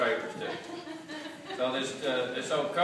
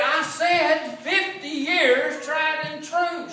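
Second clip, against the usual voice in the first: high, slow, sing-song shouted repeat; drawn-out sung shout to a deaf wife; louder repeat; 7 dB louder, much slower than usual. Retold or imitated. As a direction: belt it out slower so she finally hears. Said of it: "He said".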